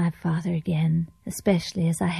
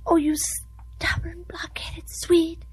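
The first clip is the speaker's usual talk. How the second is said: slow furious whisper; exasperated whisper; 7 semitones higher, slower than usual. Acted. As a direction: hiss the insult in frustration. Frustrated wife insults husband.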